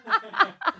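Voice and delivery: high light laugh. Real, not acted. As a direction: let out a quick laugh. Laughs at joke.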